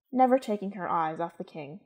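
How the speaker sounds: ordinary delivery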